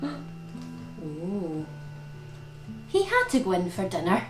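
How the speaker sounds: ordinary delivery, nothing marked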